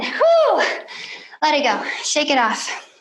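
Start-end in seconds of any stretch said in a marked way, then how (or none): 0.0-0.6 relieved whew. Real, not acted. After hard set, exhale.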